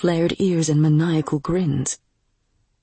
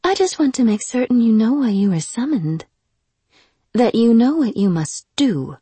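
higher, firm aristocratic voice; haughty noblewoman; 5 semitones higher, 4 dB louder than usual. Acted. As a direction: instruct him like a cold mistress. Lady instructs demon.

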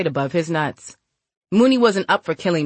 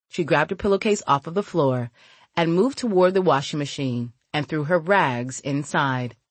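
plain reading voice

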